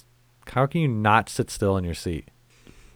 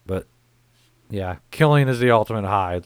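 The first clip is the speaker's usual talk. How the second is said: ordinary delivery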